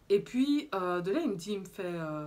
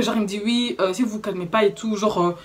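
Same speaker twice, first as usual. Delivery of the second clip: usual speaking voice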